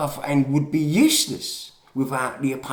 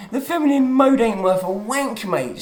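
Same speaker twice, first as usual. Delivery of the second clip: higher and louder, fired-up rant; worked-up outburst; 10 semitones higher, 8 dB louder than usual. Real, not acted. Vlogger ranting to viewers about gender modes, worked up and loud. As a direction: blurt it out, worked up and indignant. Mocking the single feminine mode.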